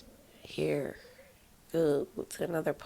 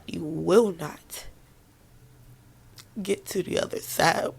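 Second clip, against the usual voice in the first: passionate urging; 3 semitones higher, 4 dB louder than usual. Real, not acted. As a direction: drive it home harder each time. Urging listeners through hardship.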